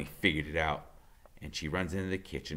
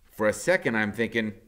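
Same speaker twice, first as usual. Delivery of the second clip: usual speaking voice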